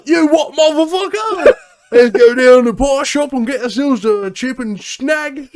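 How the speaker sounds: loud, high hammed-up Australian accent; mock Australian accent. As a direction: play a rowdy Aussie bloke, loud and high. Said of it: "we are in Australia".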